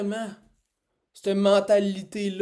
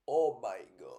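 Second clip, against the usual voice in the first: stoned; 8 semitones lower, 5 dB quieter than usual. Real, not acted. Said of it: "Je, je suis défoncé".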